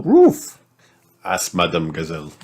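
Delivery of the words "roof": roof leaping very high; exaggerated anxious upswing; worried rise. A narrator's reading. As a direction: end the question on an alarmed high roof. Madame Gazelle's worried question.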